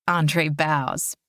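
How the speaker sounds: ordinary delivery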